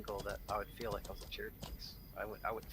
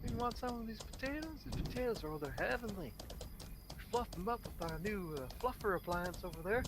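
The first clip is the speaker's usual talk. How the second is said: pushy holiday host; 9 semitones higher than usual. Retold or imitated. Mocking a smug host pushing food on a guest, high and overbearing. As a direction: lay on the hospitality too thick.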